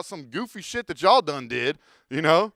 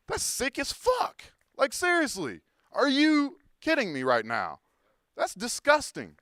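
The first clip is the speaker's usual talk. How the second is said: high, slow, appalled disbelief; disgusted outrage; 7 semitones higher, slower than usual. Real, not acted. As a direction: stretch it out in disgust.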